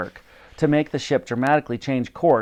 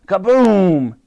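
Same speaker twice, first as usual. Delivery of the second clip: loud, higher kaboom; booming explosion; 7 semitones higher, 7 dB louder than usual. A narrator's reading. A barrel blows up, loud booming. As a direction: explode on the word.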